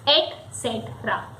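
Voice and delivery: ET CETERA as et-SET-ra, soft and falling; correct pronunciation. Shown as a demonstration. Teacher closing on the correct pronunciation. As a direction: softly model ET CETERA with no K. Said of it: "and that is".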